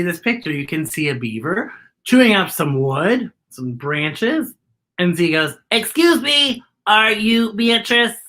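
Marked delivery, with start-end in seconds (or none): 5.7-8.2 higher, even-toned robot voice; inquiring robot Z. Acted. Robot asking a beaver if it is Beatrice, higher and mechanical. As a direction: ask like a robot, evenly and pitched up.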